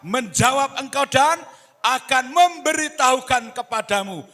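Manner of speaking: loud, high, declaimed verse; full-force scripture reading. Real, not acted. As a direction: proclaim the verse for all to join in. (membaca ayat bersama dengan suara lantang)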